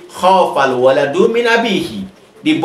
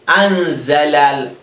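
anzala drawn out slowly, syllable by syllable; slow model word; much slower than usual. Shown as a demonstration. Teacher slowly models anzala for students. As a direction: spell out anzala slowly for the class. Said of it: "And here we say".

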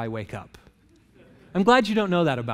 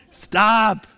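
louder, higher whine; whiny protest; 4 semitones higher, 6 dB louder than usual. Retold or imitated. Sleepy husband under the covers, whining louder. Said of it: "shrivel up in fetal position".

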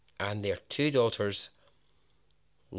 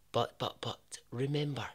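ordinary talk, nothing marked

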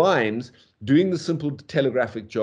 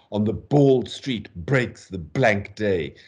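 slow line with hard B on "bald", "breaks", "blank"; heavy, separated beats on "bald street breaks" and "blank day"; spat-out B; slower than usual. Shown as a demonstration. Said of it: "the spat out B".

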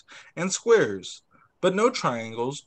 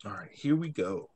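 whisper; 7 semitones lower, 7 dB quieter than usual. Real, not acted. Aside before reading.

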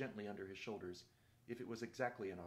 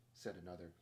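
ordinary delivery, nothing marked

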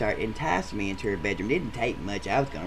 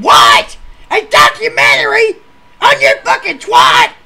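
high, loud outraged scream; screeching outrage at the date; 16 dB louder than usual. Retold or imitated. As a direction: scream it in disbelief. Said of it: "quit screaming".

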